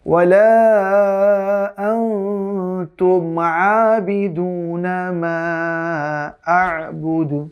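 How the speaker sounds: slow melodic tajweed chant, vowels stretched long; Quran recitation. Shown as a demonstration. Teacher recites the verse again for the class, slow chant. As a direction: chant the verse slowly and carefully. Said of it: "আবার পড়ছি আমরা".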